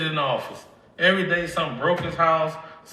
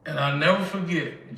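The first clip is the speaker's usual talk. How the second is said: normal talking voice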